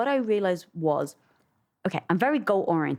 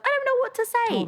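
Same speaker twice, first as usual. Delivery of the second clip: sudden very high, frightened squeal; panicked squeak. Retold or imitated. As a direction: blurt it like a terrified guest host. Panicked inner voice freezing up.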